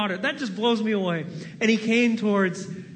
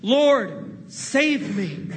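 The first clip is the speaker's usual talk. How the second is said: slow group prayer; 3 semitones higher, 3 dB louder, much slower than usual. Shown as a demonstration. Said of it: "Why don't we say that now together".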